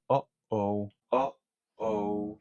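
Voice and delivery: "Oh, oh" cut by a catch in the throat; glottal stop. Shown as a demonstration. Teacher demonstrating the glottis, "Oh, oh" with glottal stops. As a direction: close the throat sharply between each "oh". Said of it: "se abre y se cierra para interrumpir el flujo de aire".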